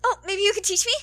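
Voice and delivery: high, loud eager cheer; cheerful ask. Acted. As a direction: cheer it out hopefully. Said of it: "he cheers".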